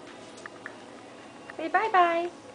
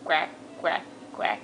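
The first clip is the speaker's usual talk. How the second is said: soft whispered duck quacks, low and breathless; 10 semitones lower, 8 dB quieter than usual. Shown as a demonstration. Mom showing toddler the duck sound, whispered quacks. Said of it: "What sound does the duck make?".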